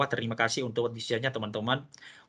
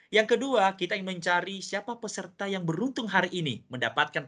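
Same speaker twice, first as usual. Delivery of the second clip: upbeat, higher-pitched prize call; booming prize announcer; 8 semitones higher than usual. Acted. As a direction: announce it like a game show host. Host announces second draw.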